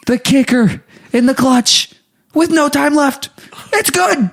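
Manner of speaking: building sportscaster excitement; mock play-by-play. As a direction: call the winning kick like a commentator. Mock announcer call of winning kick.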